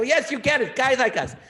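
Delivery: high, loud, elated shouting; excited screaming. Retold or imitated. Comic screaming along with his friend on the phone, high and thrilled. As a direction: scream it back to a friend who gets it. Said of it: "we were just screaming".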